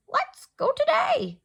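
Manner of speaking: very high, loud squawk of a bird; squawky bird. Acted. Lovebird urging Ruby to go anyway, loud high squawk. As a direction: squawk it out like an excited little bird. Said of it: "in a squawky voice".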